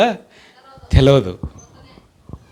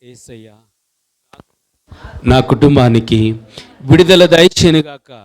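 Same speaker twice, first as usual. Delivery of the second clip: lower, hushed prayer voice with long pauses; leading prayer; 5 semitones lower than usual. Acted. Leads congregation in hands-on prayer. (తగ్గిన స్వరంతో నెమ్మదిగా ప్రార్థన)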